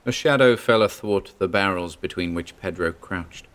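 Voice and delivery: usual speaking voice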